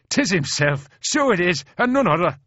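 high, loud, excited brogue; Shaughnessy agreeing. A narrator's reading. Departing private vouching for the colonel, excitedly agreeing. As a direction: proclaim it gleefully as an Irish private. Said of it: "agrees the departing Shaughnessy".

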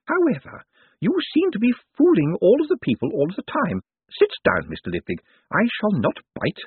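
put-on high-pitched, wry old lady; wry elderly woman's voice, pitched well up; Missus Lavish. A narrator's reading. Old lady invites him to sit.

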